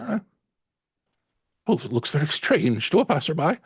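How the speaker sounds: high Irish lilt, musing; Irish landlady voice. Acted. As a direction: muse aloud in an Irish accent. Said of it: "slight Irish lilt".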